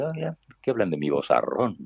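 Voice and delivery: usual speaking voice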